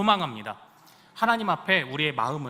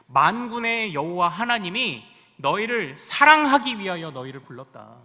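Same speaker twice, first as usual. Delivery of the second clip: solemn scripture reading; 6 dB louder than usual. Acted. Reads scripture aloud.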